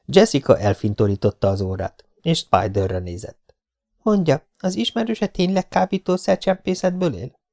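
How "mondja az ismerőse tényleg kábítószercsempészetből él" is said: higher, lighter voice for the heroine, curious; young woman's voice. A narrator's reading. Jessica asks Spider about his friend, higher curious voice. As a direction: play the young woman, a little doubtful.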